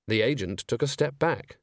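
ordinary delivery, nothing marked

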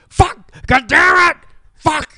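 all-out cursing shout at the family camper; mock furious yelling. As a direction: bellow it like a man covered in sewage. Dad exploding at septic tank.

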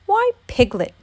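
high-pitched startled bear voice; surprised exclamation. A narrator's reading. Pooh finds Piglet without a costume, surprised. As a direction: exclaim with a big jump in pitch. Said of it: "exclaimed the surprised Pooh".

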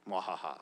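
low evil cackle; villainous mwahaha laugh, quiet and deep; mad scientist. Pretend. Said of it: "I just felt like that".

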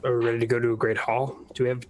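normal talking voice

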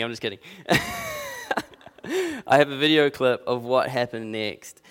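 ordinary talk, nothing marked

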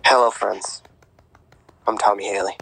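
hushed golf broadcast whisper; golf announcer whisper. Pretend. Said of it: "you gotta use your golf announcer voice".

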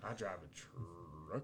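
very slow, low, soft country drawl; exaggerated rural accent rolling out "truck". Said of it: "What'd you just say?".

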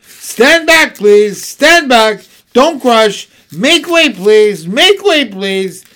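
loud, high crowd-clearing shouts; shouting keepers. A narrator's reading. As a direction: call out to push the crowd back. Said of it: "crying these words loudly".

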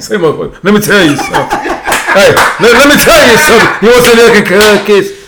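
loud, high comedian impression, laughing; hammed-up, blustering comic bit; Bernie Mac. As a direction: launch into a brash stand-up routine. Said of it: "you gotta be like Bernie Mac".

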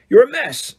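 high-pitched gruff telling-off; mildly gruff scolding; gruff but lively character voice. A narrator's reading. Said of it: "said Hagrid gruffly".

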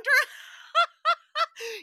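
loud high burst of laughter; high cackle. Real, not acted. Podcaster laughing at her Underlord joke, high cackle. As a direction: crack up hard.

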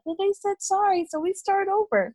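lifted, light and forgiving; chirpy, high naive tone; high sweet voice. Retold or imitated. Quoting her forgiving self.

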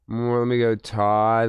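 normal talking voice

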